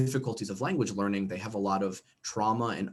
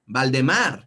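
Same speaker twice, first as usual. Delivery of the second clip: put-on Spanish accent; 5 dB louder, much slower than usual. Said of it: "if you have a Spanish accent".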